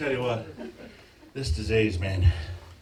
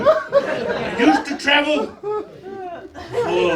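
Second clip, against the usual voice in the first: laughing, stretched slowly and higher; laughing through it; 9 semitones higher, much slower than usual. Real, not acted. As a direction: drag the words out while cracking up. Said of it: "or at least I used to".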